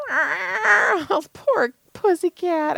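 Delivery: high, slow, tearful pity for a poor kitty; pitying coo. Retold or imitated. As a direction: coo over the cat like it breaks your heart. Pitying a cat in retold scene.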